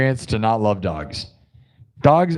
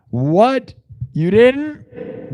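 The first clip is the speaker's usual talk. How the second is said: rising, higher-pitched disbelief; mock shock; 8 semitones higher, much slower than usual. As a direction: act scandalized, pitch climbing.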